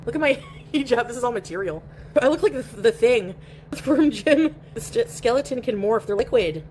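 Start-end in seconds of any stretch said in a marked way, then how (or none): none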